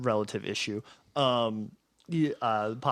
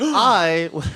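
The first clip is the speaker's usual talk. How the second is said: higher, louder start that breaks off; mock dramatic NPC; 6 semitones higher, 7 dB louder than usual. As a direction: launch the line with a flourish. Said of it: "He says".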